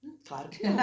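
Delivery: normal talking voice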